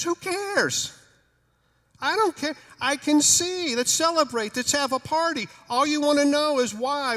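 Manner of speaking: high, loud, excited plea; high-pitched, thrilled and fed up; 1 exasperated joy. Acted. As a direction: beg them to just celebrate. Healed man pleading to celebrate.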